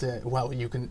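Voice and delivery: ordinary talk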